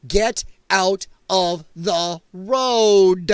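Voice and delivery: drawn-out, word-by-word "get out of the road"; slow emphatic order. Acted. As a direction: spell it out very slowly, exasperated. Exasperated order to road blockers.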